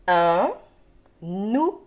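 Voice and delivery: "Un nounours" slow, un - nou - nours, long n; syllable by syllable. Shown as a demonstration. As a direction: stretch each syllable of "Un nounours", pausing between. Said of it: "des syllabes qui se donnent la main".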